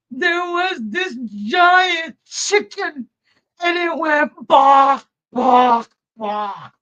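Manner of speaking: high, slow, shouting in fright; little boy shouting about his nightmare; terrified child. Retold or imitated. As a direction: cry it out like a scared little boy woken by a nightmare. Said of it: "terrified, screaming".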